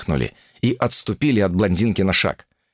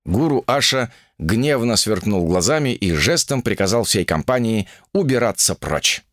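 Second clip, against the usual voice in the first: usual speaking voice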